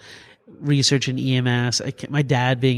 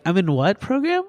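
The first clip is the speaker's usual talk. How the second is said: surprised question; 8 semitones higher than usual. Retold or imitated. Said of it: "and I'm like".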